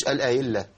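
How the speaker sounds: faulty الأهلة said al-a-illa; dropped ha. Shown as a demonstration. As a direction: swallow the ه in الأهلة. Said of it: "فين الهاء يا عم الشيخ؟".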